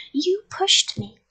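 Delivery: high, slow accusation; accuse, drawing it out; indignant accusation. A narrator's reading. Child raising a fist at the man, indignant.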